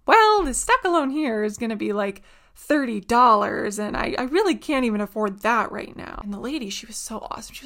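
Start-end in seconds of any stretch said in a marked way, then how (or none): 0.1-6.2 husband's retold protest. Husband balks at thirty dollars.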